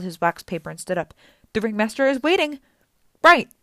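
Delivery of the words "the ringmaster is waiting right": higher, louder nervous urging; urgent shout. Acted. Grover nervously urging the group to leave, louder. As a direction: hurry everyone out.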